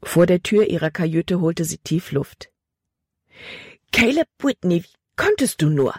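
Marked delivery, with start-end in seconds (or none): 3.9-5.9 reproachful outcry, moderately raised; exclaiming. A narrator's reading. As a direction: exclaim it as an accusation. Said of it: "rief sie aus". (vorwurfsvoll ausrufen)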